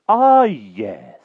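high, loud bumbling knight; blustering old knight. Acted. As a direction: bluster like a dotty old king. Pellinore voice.

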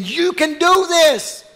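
loud, high, drawn-out cheer from heaven; belted encouragement, pitched high; rousing cheer. Acted. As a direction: cheer them on, slow and loud. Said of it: "all of heaven is standing there saying".

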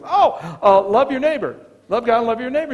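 acting out a keen pupil's answer; eager answer. Lawyer eagerly answering Jesus's question about the law, mock-eager.

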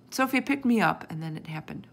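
plain reading voice

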